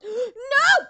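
high, loud gasping no; shocked no. Real, not acted. Gamer startled by the game, yelping loud. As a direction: cry out in sudden alarm.

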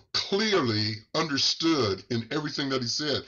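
ordinary delivery

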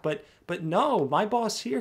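normal talking voice

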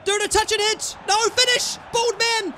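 high, loud pleading at the screen; urgent shouting. Real, not acted. Gamer urging his striker to finish, yelling. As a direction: yell him toward the goal.

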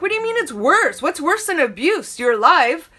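can't believe what she hears; mock alarmed outburst. Granddaughter on phone to grandmother, mock incredulous outburst.

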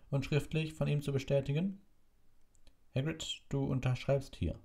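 high-pitched official reading a decree; official proclamation. Acted. Committee official reading out the execution order, high and formal. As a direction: read it out formally, pitched well up.

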